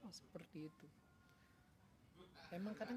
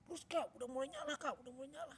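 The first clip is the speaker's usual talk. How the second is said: put-on high-pitched parent urging a child on; eager, raised-voice encouragement; excited cheering; 7 semitones higher, 4 dB louder than usual. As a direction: imitate a dad cheering a near success. Father cheering as lighter starts lighting.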